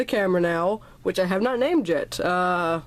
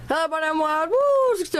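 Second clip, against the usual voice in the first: vlog intro voice; 9 semitones higher, faster than usual. Acted. Opening greeting of the vlog.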